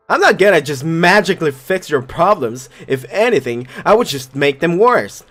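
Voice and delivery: ordinary talk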